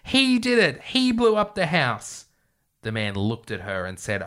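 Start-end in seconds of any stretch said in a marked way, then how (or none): 0.0-2.2 very high mock scream; hammed-up old lady wailing an accusation. A narrator's reading. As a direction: shriek it high without real force, stretching the end. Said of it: "burst into tears and screamed".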